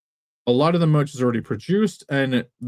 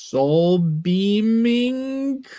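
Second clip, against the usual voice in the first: drawn out very slowly, rising in pitch; fantasy-sounding; 6 semitones higher, much slower than usual. Pretend. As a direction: stretch it like a mystical spell name. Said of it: "it's gotta be sound fantasy-ish".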